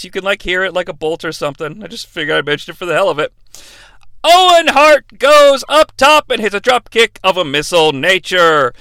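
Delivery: ordinary talk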